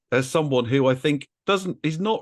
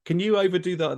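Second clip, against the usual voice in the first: normal talking voice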